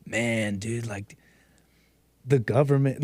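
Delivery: low, quiet, dazed stoner mumble. Retold or imitated. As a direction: drift off like a spaced-out roommate. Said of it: "he'd be like".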